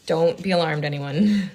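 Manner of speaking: ordinary delivery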